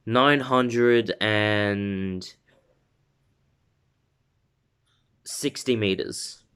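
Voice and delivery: very slow, with a long pause after "and"; game-show style suspense; suspenseful reveal. Shown as a demonstration. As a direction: draw out "nine hundred and", then drop "sixty meters". Dramatic reveal, then repeated normally.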